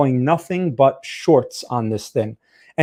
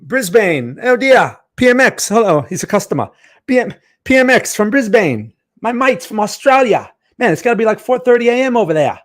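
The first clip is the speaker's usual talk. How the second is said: put-on Australian accent; 7 semitones higher than usual. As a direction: greet a customer in a playful Aussie accent. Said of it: "That's my Australian accent".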